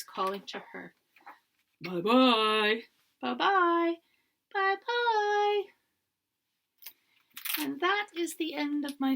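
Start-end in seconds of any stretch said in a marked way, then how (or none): 1.8-2.9 big gruff bye. A narrator's reading. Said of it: "big, gruff voice". 3.2-4.0 higher, louder Mama Bear farewell; a lightly voiced mother bear calling; middle-sized voice. A narrator's reading. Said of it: "a middle-sized voice". 4.5-5.6 very high squeaky cub farewell; high squeaking voice. A narrator's reading. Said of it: "a high, squeaking voice".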